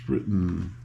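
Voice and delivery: ordinary delivery, nothing marked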